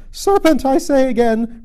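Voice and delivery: very high, loud screech; screamed repeat. A narrator's reading. Pigeon repeats its accusation at Alice, screaming. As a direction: shriek the accusation insistently. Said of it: "repeated the pigeon".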